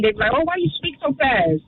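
normal talking voice

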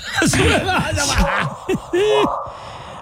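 a big high cackle of a laugh. Real, not acted. Big laugh at frog joke.

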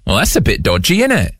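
British slang demo, rising sharply at the end; British accent. Shown as a demonstration. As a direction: teach the phrase in a put-on British accent. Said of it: "we're on British terminology".

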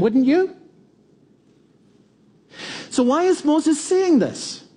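normal talking voice

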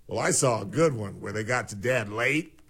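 usual speaking voice

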